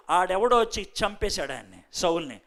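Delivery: usual speaking voice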